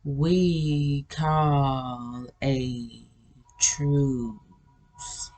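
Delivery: low, rolling, extremely slow robot voice; ancient AI voice. Retold or imitated. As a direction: draw each word out like an ancient machine. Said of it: "artificial intelligent voice was saying was".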